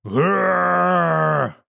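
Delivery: long, loud growling roar; drawn-out bestial bellow; monster roar. A narrator's reading. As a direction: roar like a huge beast. Buffalo-headed monster roars.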